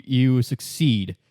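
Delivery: ordinary talk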